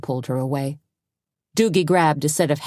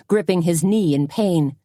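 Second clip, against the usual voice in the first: usual speaking voice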